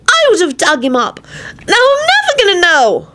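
high, climbing anguished outburst; angry shout. A narrator's reading. Timothy lamenting the missed dig, shouting. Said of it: "he finally shouted".

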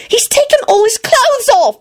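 high, appalled outcry; shuddering. A narrator's reading. Ginny reports George undressed, shuddering. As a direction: squeal it with a shudder. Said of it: "she shuddered".

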